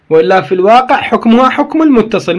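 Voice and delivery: ordinary delivery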